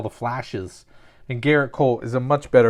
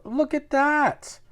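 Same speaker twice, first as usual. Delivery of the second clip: excited; 14 semitones higher, slower than usual. Real, not acted. Excited at a pulled card.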